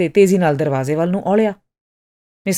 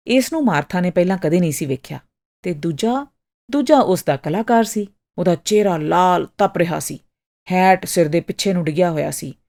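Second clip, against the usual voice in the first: plain reading voice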